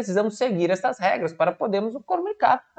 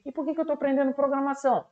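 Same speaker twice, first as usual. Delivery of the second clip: higher, quick student question; student asking why they learn programming, quick and higher; curious student voice; 7 semitones higher, faster than usual. Acted.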